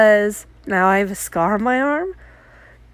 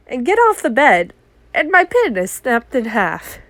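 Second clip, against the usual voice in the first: ordinary talk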